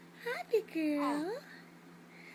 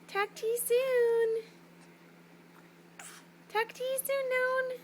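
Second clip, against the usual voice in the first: put-on higher sing-song baby talk; 5 semitones higher, faster than usual. Shown as a demonstration. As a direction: model it for the baby, brightly.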